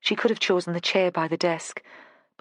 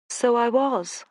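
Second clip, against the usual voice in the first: lightly voiced posh southern English lady; a hint of a posh southern English lady of the manor; 6 semitones higher, slower than usual. Joanna agrees with Vera in a posh southern English accent. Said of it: "in the posh southern accent".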